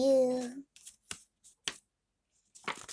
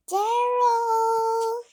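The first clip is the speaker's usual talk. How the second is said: high excited call across the page; 10 semitones higher, 6 dB louder than usual. Acted. Piggy calling out to her friend, high and loud. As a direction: call his name brightly, up high.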